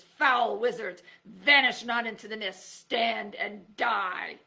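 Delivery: loud, slow medieval threat; a mad knight bellowing a curse; crazed knight. A narrator's reading.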